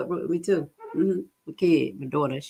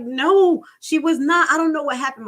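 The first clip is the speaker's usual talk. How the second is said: upset daughter; 9 semitones higher, 5 dB louder than usual. Retold or imitated. Daughter voiced again.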